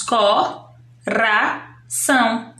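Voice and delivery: "Co, ra, são" with a pause after each syllable; counted-out syllables "Co... ra... são"; three separate sounds. Shown as a demonstration. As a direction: isolate each beat: "Co", "ra", "são". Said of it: "Eu pronuncio três sons".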